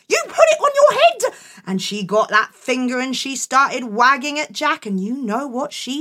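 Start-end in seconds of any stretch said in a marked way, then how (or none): 0.1-1.4 high gasped disbelief; shocked mum. Acted. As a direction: gasp it out, appalled.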